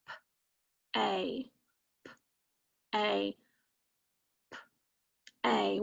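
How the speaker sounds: P and A said one by one, softly; sounds said apart. Shown as a demonstration. Teacher segmenting sounds for kids, soft and separate. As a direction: break pay into its two sounds, P then A. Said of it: "we're gonna keep the A".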